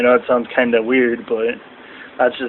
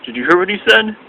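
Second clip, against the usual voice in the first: higher, full-volume repeat; imitating R-Truth; 7 semitones higher than usual. R-Truth repeats to imaginary friends, higher full voice. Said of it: "pretending like he has imaginary friends".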